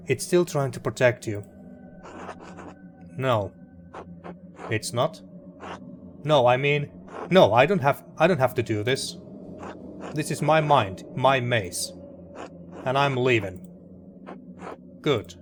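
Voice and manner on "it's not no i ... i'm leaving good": ordinary talk, nothing marked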